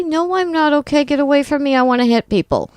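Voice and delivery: high-pitched, comic angry protest; exasperated high voice, played for laughs; mock upset retort. Acting out tearful angry retort.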